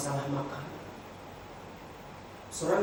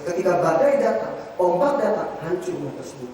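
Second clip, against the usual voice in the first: loud, driving sermon voice; preaching at full force; 2 semitones higher, 9 dB louder than usual. Acted. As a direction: hammer the parable home to the congregation.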